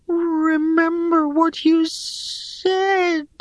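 very high, slow treeling plea; slow, high, anxious old creature; Kuwain, urging. A narrator's reading. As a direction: urge in a drawn-out high creature voice. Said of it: "urged Kuwain".

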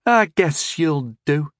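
slow, grudging grunt; grudging approval. A narrator's reading. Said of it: "he grunted".

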